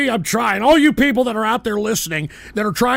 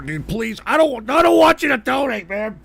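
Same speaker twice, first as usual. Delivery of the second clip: normal talking voice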